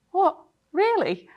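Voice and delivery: delighted disbelief breaking through; amazed disbelief. Retold or imitated. Runner answering the lead-bike cyclist, leaping amazed disbelief.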